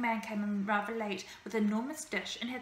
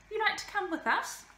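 bearded lady voice; 9 semitones higher, 3 dB louder than usual. A narrator's reading. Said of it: "said the bearded lady".

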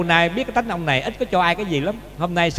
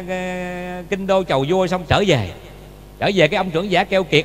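ordinary delivery

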